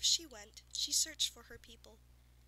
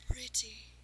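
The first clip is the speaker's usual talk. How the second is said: whispered between gasps; breathless gasp; 6 dB louder than usual. Acted. Winded farmer gasping at the unicorn. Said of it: "he gasped".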